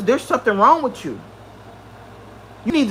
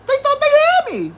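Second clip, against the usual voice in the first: mocking high squeal; 14 semitones higher than usual. Mocking enemies' certainty.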